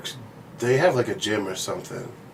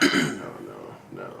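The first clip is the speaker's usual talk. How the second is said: low, quiet string of no's; retold reluctant refusal; 5 dB quieter, slower than usual. Man talking himself out of working out, reluctant refusal. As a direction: talk yourself out of it under your voice. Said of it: "then I'm just like".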